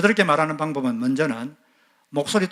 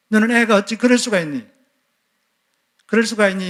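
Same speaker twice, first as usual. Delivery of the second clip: raised, higher scolding cry; angry mother; 6 semitones higher, 4 dB louder than usual. Retold or imitated. Mother scolding a child who came home late, raised angry voice. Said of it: "화가 나가지고 당황같이 말했다고 합시다".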